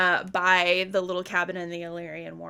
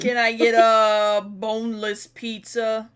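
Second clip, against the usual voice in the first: louder goofy customer voice; put-on meme voice; 6 dB louder than usual. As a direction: order it like a silly meme caller.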